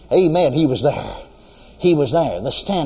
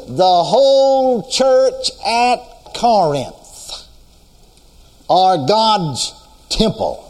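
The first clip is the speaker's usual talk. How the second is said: full-force preaching; 4 semitones higher than usual. Acted. Preacher stirring the congregation about the standby, full-force preaching. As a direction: preach it out with rising fire.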